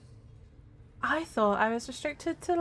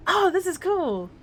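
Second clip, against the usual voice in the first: loud, high and thrilled; excited; 10 semitones higher, 7 dB louder than usual. Real, not acted. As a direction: blurt it out with delight. Excited by new dialogue option.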